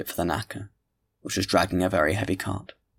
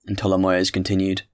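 ordinary delivery

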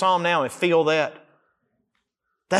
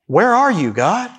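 crying out to God; 5 semitones higher, 4 dB louder than usual. Acted. Voicing the psalmist crying to God.

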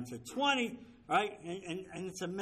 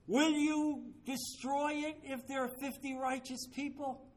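Abraham pleading with God; 8 semitones higher than usual. Retold or imitated. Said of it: "now he's negotiating with the Lord".

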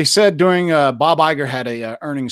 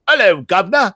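hammed-up posh British greeting, raised a little; mock British accent; 6 semitones higher, 4 dB louder than usual. Mocking how American actors force a British accent, overdone fake British accent. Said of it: "like an American actor forcing a British accent".

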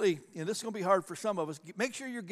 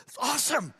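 high-pitched, hammed-up delight; thrilled pastor, voice leaping up high; all excited; 7 semitones higher than usual. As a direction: gush it high, mock-giddy. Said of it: "I got all excited".

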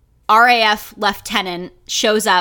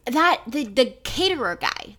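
ordinary talk, nothing marked

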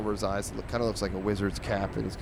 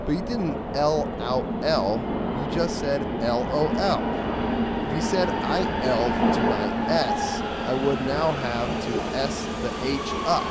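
higher, louder cat correcting the dog; pedantic cartoon cat; 5 semitones higher, 5 dB louder than usual. Cat picks apart the dog's L O L, pedantic. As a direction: argue the point as a fussy comic-strip cat. Reads the cat's comic rant.

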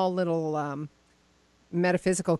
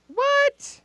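high, loud "what?" of disbelief; stunned, high-pitched outburst; astonished; 6 dB louder than usual. Real, not acted. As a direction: squeal it in sheer disbelief. Shocked by psychics-only town.

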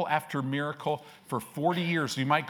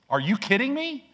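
a much higher mock disbelief; incredulous; 9 semitones higher than usual. Real, not acted. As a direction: scoff in disbelief. Preacher's incredulous outburst.

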